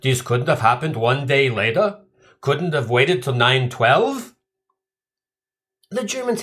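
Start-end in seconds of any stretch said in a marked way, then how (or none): 0.0-4.4 mock German accent, slow on the second line; lightly hammed German grumble. Germans grumbling over bad timing, in mock German accent. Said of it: "they had to be saying".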